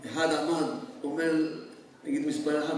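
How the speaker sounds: ordinary talk, nothing marked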